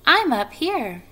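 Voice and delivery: high little call; small voice. A narrator's reading. Tiny spider tells Wilbur where to look, in a small high voice. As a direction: pipe it down from above. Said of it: "he heard a small voice".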